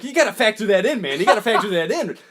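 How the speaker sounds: high, loud, insistent put-on voice; hammed-up Tom Cruise scolding; mock lecturing Cruise. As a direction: preach punctuality. Cruise lecturing late driver.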